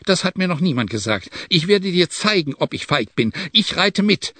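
indignant exclaiming. A narrator's reading. Chalef insisting he will ride along, indignant exclaiming. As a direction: prove your courage in a rising voice. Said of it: "rief Chalef".

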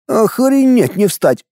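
high, slow exclamation of amazement; exasperated astonishment, high-pitched; heartfelt outburst. A narrator's reading. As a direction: let it burst out, drawn out. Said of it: "в сердцах высказался один".

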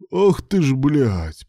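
high, loud exclamation dropping in pitch; shocked curse. A narrator's reading. Rescuer seeing the crushed victim, shocked curse. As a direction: curse in dismay.

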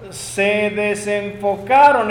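ordinary talk, nothing marked

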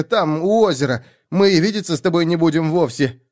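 high, anxious stream of promises; desperate pleading. A narrator's reading. Man eyeing the blade begs to be released, desperate.

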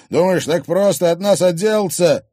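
loud, high threatening retort; angry. Acted. Thug refusing to be dismissed, angry. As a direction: challenge her angrily. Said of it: "разозлился Рыжий".

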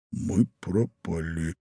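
low, hushed and slow; whisper. A narrator's reading. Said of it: "прошептал молодой человек". (низкий испуганный шёпот)

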